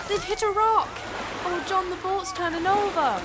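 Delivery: high, loud panicked shout; alarmed cry. Acted. Girl seeing the boat turn over, panicked cry. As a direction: cry out in horror at an accident.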